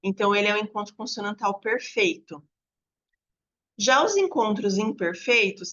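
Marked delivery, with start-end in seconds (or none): none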